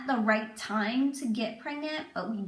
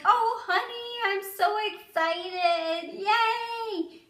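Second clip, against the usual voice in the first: very high, drawn-out sing-song squeal; gushing mom; 11 semitones higher, 3 dB louder, much slower than usual. Retold or imitated. Mom hearing of her first grandchild, hammed-up gushing. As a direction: imitate a mom cooing with delight.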